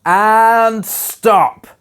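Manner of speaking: loud, high call to end it. Real, not acted. Teacher ending the robot reading with a loud call. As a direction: call the class to a stop. Teacher calls class to stop.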